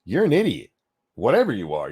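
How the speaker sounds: normal talking voice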